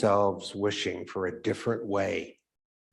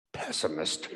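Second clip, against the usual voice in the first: low muttered word, under the breath; mumbled; 5 semitones lower, 8 dB quieter than usual. Acted. Edward grumbles at his partner, mumbled. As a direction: grumble it to yourself. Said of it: "mumbled Edward".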